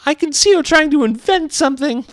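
very high, loud bird voice; put-on piercing accusation; accusing pigeon. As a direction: screech it with open suspicion. Pigeon accuses Alice of inventing.

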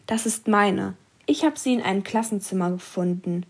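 usual speaking voice

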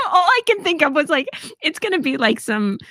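talking through real laughter, pitched high; laughing. Real, not acted. Mother recalling wearing metal near the MRI, laughing. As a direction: laugh it out at the memory.

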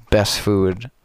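ordinary talk

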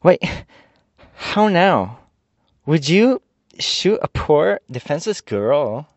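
high, slow, wounded reproach; reproachful. A narrator's reading. Ginger to the armed soldier, high slow reproach. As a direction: shame him for aiming at her. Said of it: "said reproachfully".

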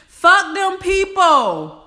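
loud, high, forceful; defiant outburst. Real, not acted. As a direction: shout your freedom from others' eyes. Passionate outburst against pleasing others.

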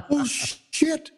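high-pitched retold gasp of shock; stunned shock. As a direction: react to life-changing news, voice leaping up. Retold shock at pregnancy news.

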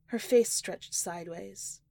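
plain reading voice

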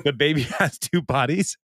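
giggling, acted bewilderment; laughing disbelief. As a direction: retell a baffled question while cracking up. Baffled question retold through laughter.

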